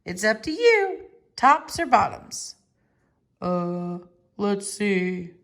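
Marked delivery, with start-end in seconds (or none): 3.4-5.3 yawning. Acted. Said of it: "Bear said with a yawn".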